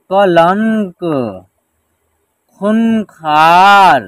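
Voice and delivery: measured reading-lesson delivery, long held syllables; word-by-word pronunciation drill. Shown as a demonstration. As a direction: stretch each word for learners to repeat. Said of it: "एक साथ उच्चारण करेंगे".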